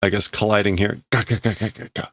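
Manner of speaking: usual speaking voice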